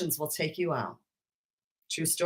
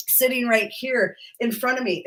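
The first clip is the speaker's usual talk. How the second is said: ordinary talk, nothing marked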